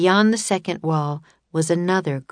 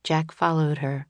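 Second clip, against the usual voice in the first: ordinary delivery, nothing marked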